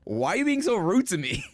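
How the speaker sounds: put-on dad's half-joking inner outburst at his four-year-old; exasperated inner rant. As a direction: vent it like a hurt, petty dad. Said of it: "Now it's like".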